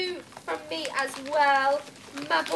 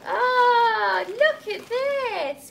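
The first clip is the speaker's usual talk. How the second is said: gasp, then a high, loud gush; slow, marvelling high-pitched exclamation; delighted awe; 8 semitones higher, 6 dB louder, much slower than usual. Real, not acted. Vlogger opening Pocus's present, delighted awe.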